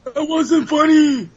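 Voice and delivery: high, slow mock-offended voice. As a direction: act offended in a put-on voice.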